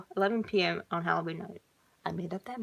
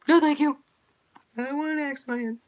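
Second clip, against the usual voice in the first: panicked refusal; 6 semitones higher, 7 dB louder, faster than usual. Acted. Girl refusing, laughing.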